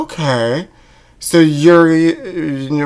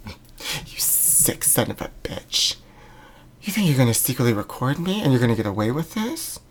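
menacing whisper; 11 dB quieter than usual. Acted. Hissing threat after break.

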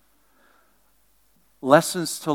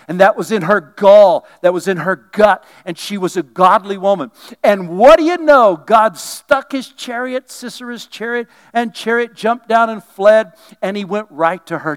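preaching; 6 semitones higher, 7 dB louder than usual. Acted. Preaching at full force.

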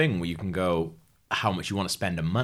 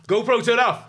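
raised, high voice command; loud, pitched-up order to a device; calling out; 13 semitones higher, 5 dB louder than usual. Acted. Ordering a GoPro to switch off, calling out.

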